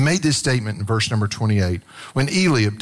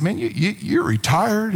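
higher mock amazement; teasing surprise; 7 semitones higher than usual. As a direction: tease a retiree, amazed. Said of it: "kind of teasing with them".

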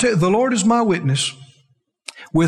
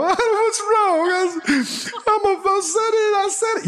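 high, whiny mock sobbing; mock crying; 11 semitones higher than usual. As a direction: whimper like a let-down believer. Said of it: "then they cry and go".

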